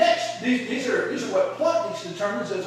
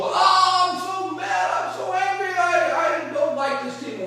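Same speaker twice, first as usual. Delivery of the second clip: high-pitched, hammed-up angry whine; mock emotional outburst; 9 semitones higher, slower than usual. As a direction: cry out like an over-emotional player throwing a fit. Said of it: "don't go out there and have this emotional".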